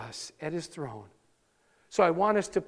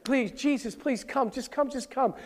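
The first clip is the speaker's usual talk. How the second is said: higher, imploring, a touch desperate; earnest pleading; 6 semitones higher, 4 dB quieter than usual. Retold or imitated. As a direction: beg Jesus to come, lightly acted. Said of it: "He's pleading earnestly over and over".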